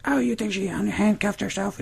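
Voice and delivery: sceptical mock-mom falsetto; Daisy. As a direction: imitate a challenging mother, very high. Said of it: "And she's like".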